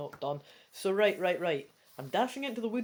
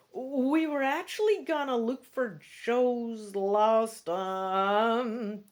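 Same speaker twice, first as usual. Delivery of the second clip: slow, halting, a bit louder; stammering; 3 semitones higher, 5 dB louder, much slower than usual. Acted. Girl stalling to cover her friend, stammering. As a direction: stall for time mid-sentence. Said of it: "you stammer".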